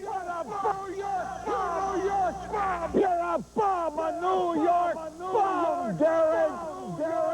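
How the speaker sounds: high, booming ballpark bellow, slowed on the insults; the old man's booming voice jeering Gehrig from the stands; booming heckle. Retold or imitated. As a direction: holler across the stadium at the first baseman. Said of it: "When he let go his first shot".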